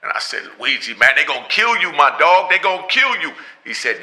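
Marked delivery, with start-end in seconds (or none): 0.6-3.3 louder, urgent plea; urgent warning. Retold or imitated. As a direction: warn him hard, pleading. Pleading with friend about danger.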